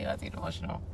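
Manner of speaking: unvoiced, breaking whisper; choked whisper. Real, not acted. Whispering to her viewers as she tears up. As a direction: barely get the words out, close to tears.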